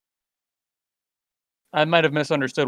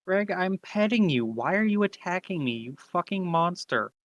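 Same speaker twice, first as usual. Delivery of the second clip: higher and slow, hammed-up hurt protest; mock betrayed pet owner; 3 semitones higher, 8 dB quieter, slower than usual. Character protests to the attacking pet, mock hurt and slow. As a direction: whine at the pet that turned on you.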